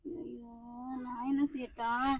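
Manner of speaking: very soft, slow, low moan; despairing lament.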